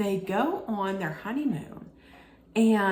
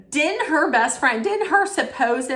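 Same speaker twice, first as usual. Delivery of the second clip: excited; 7 semitones higher, 4 dB louder than usual. Real, not acted. Building to trial gossip reveal.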